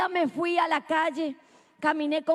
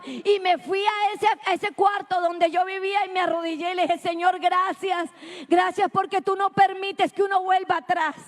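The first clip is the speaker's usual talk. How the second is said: raised-pitch testimony from the pulpit; impassioned preaching; 3 semitones higher than usual. Real, not acted. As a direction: preach your story with rising fervor. (prédica testimonial con fervor)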